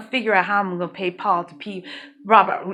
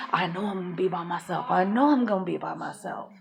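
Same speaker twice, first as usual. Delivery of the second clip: softer, hushed worried self-talk; quiet inner worry; 4 semitones lower, 7 dB quieter than usual. Retold or imitated. New mom alone with a hip injury, softer, fearful self-talk. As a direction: murmur it to yourself, softer, in fear.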